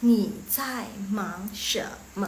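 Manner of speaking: slow, each syllable separate; slow teaching model. Shown as a demonstration. Teacher recapping a question for learners, slowly. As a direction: spell it out word by word.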